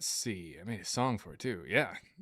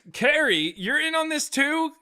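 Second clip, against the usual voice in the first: mock outrage; 6 dB louder than usual. Real, not acted.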